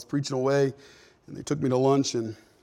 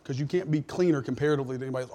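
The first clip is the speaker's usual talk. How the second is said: normal talking voice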